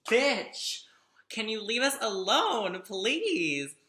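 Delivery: high, loud mock-exasperated plea; hammed-up "back off" protest; exasperated retold plea. Retelling her plea to the follower.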